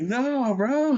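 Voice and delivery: squeaky scared no; high mock refusal. Imagined ghost hunters refusing, high scared voice. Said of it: "We'd be like".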